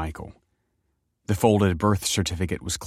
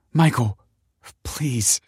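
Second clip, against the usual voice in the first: high, desperate plea; tearful begging; 6 semitones higher, 3 dB louder, slower than usual. A narrator's reading. Kelsey begs Michael as he brushes past, tearful. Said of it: "she begged".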